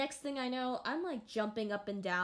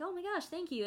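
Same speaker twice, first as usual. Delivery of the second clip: high, gushy imitation of a flattered girl; pitched-up grateful gush; gushing thanks; 9 semitones higher, faster than usual. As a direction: squeal it lightly, full of thanks. Imitates girl thanking her for compliment.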